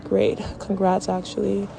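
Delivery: rally the viewers like a closing speech; motivational speech. Real, not acted.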